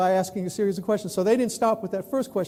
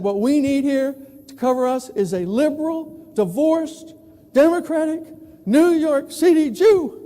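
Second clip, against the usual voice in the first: rising to high, loud punchline; building mock outrage; 5 semitones higher, 5 dB louder than usual. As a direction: build each label higher and louder. Ironic imagined hiring quote.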